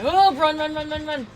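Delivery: high urgent yell to flee; urgent shouting. Real, not acted. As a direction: shout run with rising panic. Urging teammate to flee.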